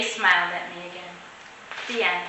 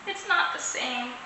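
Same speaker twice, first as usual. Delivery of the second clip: sigh; 8 dB quieter than usual. A narrator's reading. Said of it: "I sighed".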